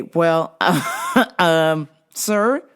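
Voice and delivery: normal talking voice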